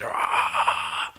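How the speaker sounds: rasping, voiceless mock metal scream; hoarse imitation of screamed vocals; angry scream. Shown as a demonstration. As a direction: let out a long throaty scream. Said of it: "get all of the fucking anger out".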